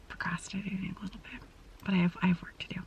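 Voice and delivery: soft unvoiced whisper, a bit quick. Real, not acted. Vlogger confessing to viewers she's procrastinating, whispered. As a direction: whisper it like a guilty secret.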